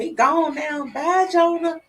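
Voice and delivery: put-on high child voice saying goodbye; little boy voice. Boy says bye as the train passes, high child voice. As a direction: wave him off in a small boy's voice. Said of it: "He said".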